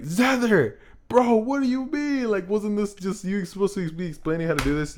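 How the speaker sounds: high, whiny puzzled viewer; confused viewer voice. Retold or imitated. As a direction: play a baffled fan calling him out. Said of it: "You guys are probably like".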